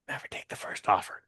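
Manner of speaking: soft unvoiced whisper. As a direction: breathe it as a secret. Retold advice whispered.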